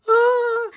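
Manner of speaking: high, loud gasp of surprise; excited mock gasp. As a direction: act out a sudden high squeal. Retells gasp at seeing the rabbit.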